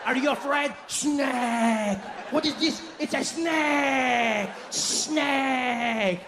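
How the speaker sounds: put-on high, long-drawn French-accented jeer; hammed-up French taunting; mocking French accent. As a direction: ridicule him, wailing out each key word. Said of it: "he's like".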